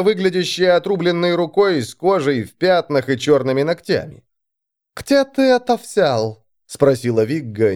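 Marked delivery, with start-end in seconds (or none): 5.0-6.4 high, slow casual question; mild curiosity. A narrator's reading. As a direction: ask it slowly, out of idle curiosity. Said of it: "не более чем из любопытства".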